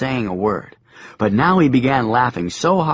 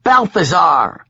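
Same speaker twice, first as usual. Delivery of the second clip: loud slow shout; 7 semitones higher, 6 dB louder, slower than usual. A narrator's reading. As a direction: shout the name to scare her. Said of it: "I shouted at her".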